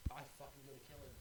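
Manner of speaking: ordinary talk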